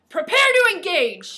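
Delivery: high, loud, slow military command; shouted order. A narrator's reading. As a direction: command the men to engage. Read order of a soldier.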